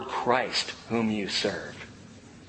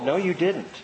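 ordinary delivery

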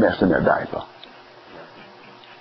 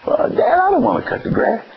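teenager voiced much higher, whining back at dad; whiny child; 14 semitones higher than usual. Preacher voicing a sulky child refusing to mow the lawn, high whine. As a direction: pout it out like a kid who won't mow.

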